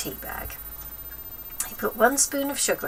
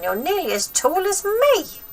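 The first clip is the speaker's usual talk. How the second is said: grandma voice; 12 semitones higher than usual. Acted. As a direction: nag the boy like a spiteful old grandma.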